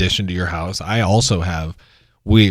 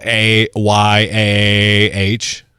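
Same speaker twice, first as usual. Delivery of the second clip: slow, drawn-out letter by letter; deliberate spelling with long stretched letters; much slower than usual. Real, not acted. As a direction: spell each letter out very slowly. Spelling out the note's word.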